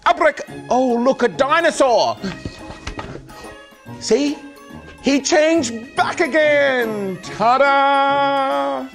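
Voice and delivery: loud, high showman patter; kids party magician. Acted. Magic show for children.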